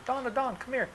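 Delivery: high sing-song call of his name across a room; locals calling him. Retold or imitated. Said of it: "so they call me Adon".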